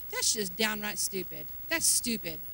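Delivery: low, soft aside to self; quietly disgusted with yourself; muttered self-reproach. Retold or imitated. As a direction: mutter it under your breath. Recalls scolding own prayer in thought.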